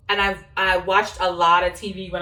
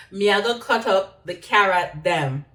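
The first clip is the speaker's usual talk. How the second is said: Jamaican Patois accent. Shown as a demonstration. Vlogger demonstrating patois while cutting carrots, patois accent. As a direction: narrate the chopping in Jamaican Patois. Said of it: "I'll talk patois".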